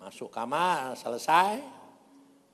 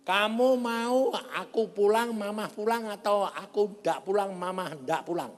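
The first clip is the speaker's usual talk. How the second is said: high, loud retort easing toward the end; acted-out heated challenge; arguing back; 7 semitones higher, 4 dB louder than usual. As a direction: argue the choice forcefully at the family. Acted quarrel with angry family.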